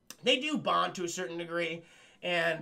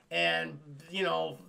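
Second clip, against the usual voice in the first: ordinary talk, nothing marked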